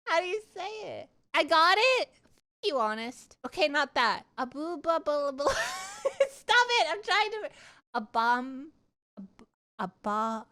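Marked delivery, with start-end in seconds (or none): none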